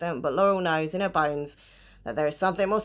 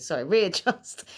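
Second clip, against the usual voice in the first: ordinary talk, nothing marked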